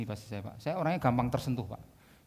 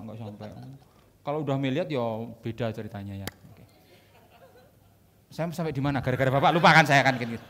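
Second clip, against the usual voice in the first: ordinary talk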